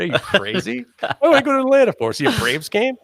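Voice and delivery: high-pitched scoffing questions; mock incredulous. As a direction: act out an exaggerated dismissal. Mock refusal to attend Blade Show.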